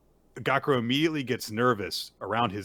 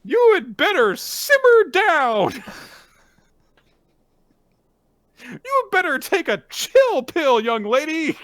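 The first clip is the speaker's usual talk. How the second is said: much higher, louder, slow mock scold; mock scolding parent; 8 dB louder than usual. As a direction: scold a child in a shrill parent voice. Hosts riff as scolding parents.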